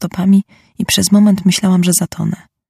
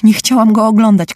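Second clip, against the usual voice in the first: screaming; 5 semitones higher, 5 dB louder than usual. A narrator's reading. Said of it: "krzyczała pani Churchill".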